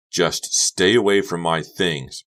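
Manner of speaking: slow, loud clenched warning; through clenched teeth. Acted. Becca warns Honey off, slow through clenched teeth. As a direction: grind it out through your teeth. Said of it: "through clenched teeth".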